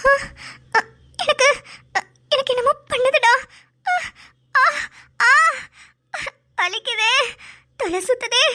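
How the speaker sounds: hammed-up groans of pain, broken words; fake pain moaning. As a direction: overact being hurt so he feels guilty. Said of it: "ரொம்ப over acting பண்ணிட்டோமோ".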